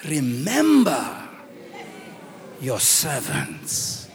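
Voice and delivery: very slow, low, weighty plea; solemn appeal. Acted. Moses reminds God of his covenant, solemn. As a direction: draw out each word, dropping deep.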